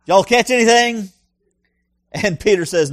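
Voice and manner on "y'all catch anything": a friendly Southern holler from the shore, mildly done; Jesus calling to the fishermen in a light Southern accent. Acted. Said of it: "That's Southern Jesus".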